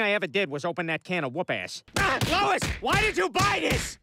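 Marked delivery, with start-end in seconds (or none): 2.0-4.0 high cry of complaint to wife; panicked wail. Acted. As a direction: wail as it goes wrong. In trouble, calls out to wife.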